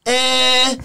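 drawn-out Scouse "eh"; Scouse accent. Retold or imitated. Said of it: "which already is pretty Scouse".

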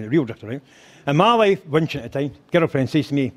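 normal talking voice

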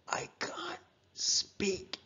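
slow, soft whisper; laboured unvoiced words; voiceless reply. A narrator's reading. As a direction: force it out with no voice. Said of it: "because he couldn't".

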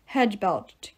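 ordinary delivery

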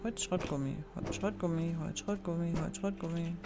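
quiet muttered chant; vor sich hin murmeln. Real, not acted. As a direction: mumble the list over and over. Repeats recipe to self. (leise murmelnd wiederholt)

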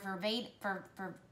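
stumbling over the word from excitement; excited stammer, tripping on ver-; stuttering. Real, not acted. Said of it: "why am I stuttering? 'Cause I'm so excited".

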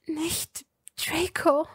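whispered, slow restraining plea; urgent warning whisper. A narrator's reading. Hermine holding Draco back, whispering urgently. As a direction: hold him back in a hushed warning. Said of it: "mahnte ihn Hermine".

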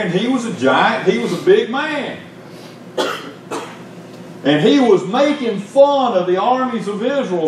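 high, driving sermon storytelling; impassioned preaching. Real, not acted. Preacher retells Goliath taunting Israel's armies, impassioned. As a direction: preach the Bible tale with lifted pitch.

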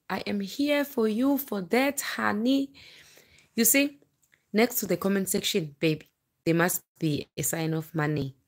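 ordinary delivery

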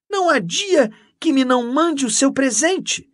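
loud, high, excited enthusiasm; gushing. A narrator's reading. Canon boasting of her gifts to Mendes, gushing. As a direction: gush over each treat, pitched well up.

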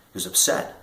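normal talking voice

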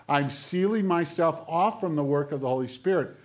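loud, insistent sermon voice; forceful preaching. Acted. Preacher warning of becoming hard-hearted, forceful preaching. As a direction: press the conviction on the congregation.

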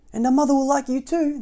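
high, loud, eager little voices; baby birds. A narrator's reading. Baby birds promise the duckling, high and eager. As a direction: chirp the promise like tiny birds.

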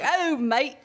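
hammed-up Aussie twang; bad Australian. Teasing her Australian publicist in a mock Australian accent. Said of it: "my Australian dialect is so bad".

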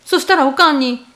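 ordinary delivery, nothing marked

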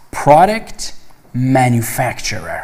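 slow, deliberate English model; careful pronunciation demo. Shown as a demonstration. Teacher modelling the term for students, slow and clear. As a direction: spell out each syllable for learners. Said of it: "خوب دقت کنید".

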